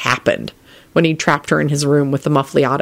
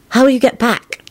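ordinary delivery

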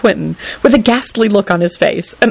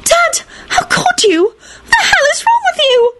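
horrified screaming. Acted. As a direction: screech the accusation at her father. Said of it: "Ginny screamed".

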